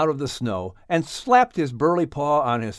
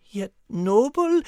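high French lady searching for her dog; Liz fussing; 13 semitones higher than usual. Acted. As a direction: call out then grumble. Liz voiced in home scene.

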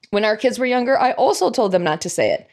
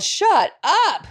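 high mock toddler shout of shut up; a two-year-old yelling at a visitor, mock shout; toddler's yell; 8 semitones higher than usual. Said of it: "and yelled".

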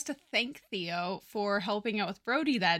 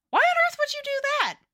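much higher, louder, fast squeal of disbelief; shrill disbelief; 6 dB louder, much faster than usual. Acted. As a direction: squeak it out in total shock. Sheldon incredulous at Theo's offer.

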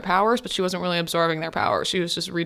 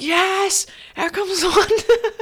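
excited cheer; 12 semitones higher, slower than usual. Real, not acted. Fan cheering as Wanda appears, excited squeal.

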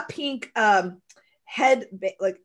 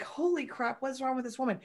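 muted disbelief; 3 semitones higher, 8 dB quieter than usual. Retold or imitated. Imitating strangers reacting to her shouted greeting, hushed disbelief. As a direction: drop the volume, imitating baffled onlookers. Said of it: "they just, like".

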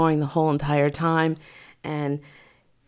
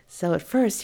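usual speaking voice

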